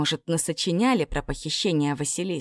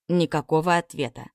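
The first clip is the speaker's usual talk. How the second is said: ordinary delivery, nothing marked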